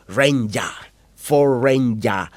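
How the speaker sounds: drawn-out Queensland "Ranger", ending in an open "ya"; mock Queenslander accent. Newcomer imitates the locals' name for the truck, mock Queenslander accent. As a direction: imitate a slow Queenslander saying Ford "Ranger". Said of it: "How can you call the Ford Ranger".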